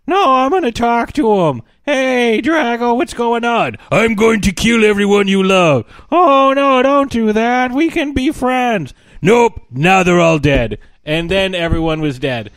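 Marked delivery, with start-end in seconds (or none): 0.1-3.8 put-on high, loud, eager whiny teen; Hiccup. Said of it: "impression of the squeaky voice teen". 3.9-5.9 loud, raised, put-on villain voice; mock villain threat. Villain's threat in acted exchange. 6.1-8.9 high, loud, whiny pleading; Hiccup. Retold or imitated. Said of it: "impression of the squeaky voice teen". 9.2-10.8 loud, slow, blunt villain voice; mock villain reply. Villain's reply in acted exchange.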